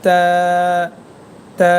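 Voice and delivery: তা held long, tongue tip on the roots of the upper front teeth; drawn-out, dental তা; letter articulation demo. Shown as a demonstration. Teacher showing the letter ta to learners, long dental তা. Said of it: "এই তা হরফটি উচ্চারণ".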